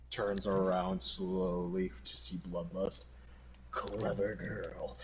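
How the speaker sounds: low, slow and soft; hushed dramatic narration. Acted. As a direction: play a slow, quiet doomed realization. Acting out a film-style death scene.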